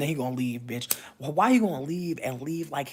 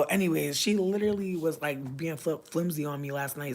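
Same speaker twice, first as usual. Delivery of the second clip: normal talking voice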